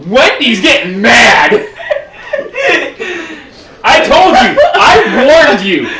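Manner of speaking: laughing shout. Real, not acted. GM laughing warns player.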